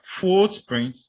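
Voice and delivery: footprints slowly, FOOT loud and long; stress on first word. Shown as a demonstration. Teacher listing compounds, footprints stressed loudly on FOOT. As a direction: punch FOOT, let prints drop. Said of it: "on the first syllable".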